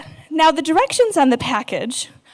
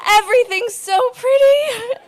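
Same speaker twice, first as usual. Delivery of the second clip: much higher, laughing and crying, "everything's so pretty" in a dazed rush; giddy high; 10 semitones higher than usual. Retold or imitated. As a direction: marvel at the rainbows, half-laughing, half-sobbing. High on Ambien in shower.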